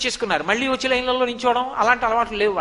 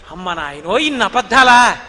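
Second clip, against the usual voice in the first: louder hammed-up shock; mock astonishment; 3 semitones lower, 7 dB louder than usual. Milkmaid exclaims at Krishna's lies, loud mock astonishment. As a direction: clutch your chest and exclaim. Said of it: "అని ఆవిడ గుండెల మీద ఇలా చెయ్యేసుకోవడంలో".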